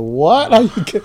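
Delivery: high, loud gasped disbelief; stunned disbelief. Retold or imitated. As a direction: blurt it out like you can't believe the news. Retelling shock at the appointment news.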